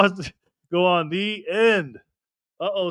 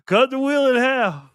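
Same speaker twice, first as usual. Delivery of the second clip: normal talking voice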